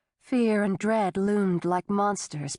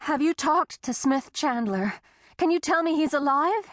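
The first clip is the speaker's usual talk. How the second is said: higher, urgent plea; anxious pleading; 7 semitones higher than usual. Acted. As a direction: beg for news. Tessa pleading.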